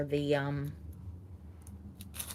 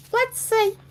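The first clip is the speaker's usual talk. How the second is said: "Let's see" popped up very high and a bit louder; playful squeak; 5 dB louder than usual. Pretend. Host to viewers, repeating "Let's see" in a high squeak. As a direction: squeak the words up high for fun.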